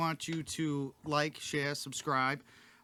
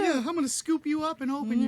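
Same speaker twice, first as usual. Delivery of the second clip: mock doting voice; 13 semitones higher, 6 dB louder than usual. Answering as the doting owner.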